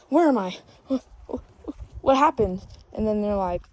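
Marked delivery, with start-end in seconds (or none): 0.0-2.6 gasping, raised, loud confusion; hammed-up panicked waking; mock panic. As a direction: gasp between words as if just woken.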